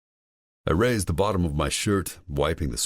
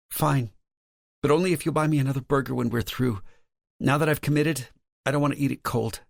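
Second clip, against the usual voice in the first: higher, lightly voiced woman; female character voice; 12 semitones higher than usual. A narrator's reading. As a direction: play Lauren, higher pitch.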